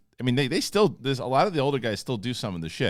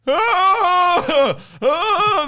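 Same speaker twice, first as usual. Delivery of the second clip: very high, loud scream; full-volume mock panic yell; imitated yell at a bear; 9 dB louder than usual. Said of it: "just yell at the bear".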